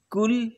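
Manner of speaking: «कुल» lifted up in pitch; second take of कुल. Shown as a demonstration. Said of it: "ऐसे अलग-अलग प्रकार होता है".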